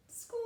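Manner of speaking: long thin rising door squeak; squeaky door. Acted. Storyteller voices the bedroom door closing, drawn-out squeak. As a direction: imitate a slowly creaking door.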